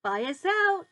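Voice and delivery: put-on boy's bright proud call; proud announcement. A narrator's reading. George proudly telling Grandma the fire is out. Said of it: "George announced proudly".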